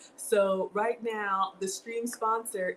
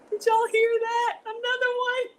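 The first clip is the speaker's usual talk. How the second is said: very high, louder thrilled squeal; excited squeal; 5 dB louder than usual. Real, not acted. Hearing another donation ding, high excited squeal. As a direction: squeal it out at the chat.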